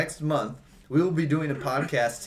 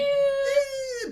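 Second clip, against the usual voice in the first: surprised yelp. Real, not acted. Mock protest on a claim.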